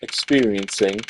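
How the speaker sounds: low, measured inner monologue; a composed prosecutor thinking aloud, low and calm; Miles Edgeworth. Acted. Edgeworth musing to himself on the plane, low and composed.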